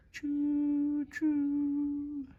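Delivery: high long choo choo; train whistle. Acted. Bedtime train story, high drawn-out choo.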